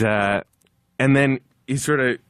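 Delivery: ordinary talk, nothing marked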